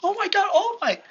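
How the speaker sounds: high, loud fan squeal; starstruck exclamation. Acted. Deku seeing All Might arrive, acted starstruck outburst. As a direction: squeal as if meeting your idol.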